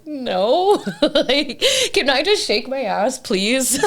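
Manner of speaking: high, giggling protest; laughing refusal. Retold or imitated. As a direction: laugh through the no. Retelling her own tearful refusal.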